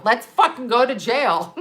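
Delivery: laughing through the dare. Real, not acted. Laughing as she dares the cop to jail her.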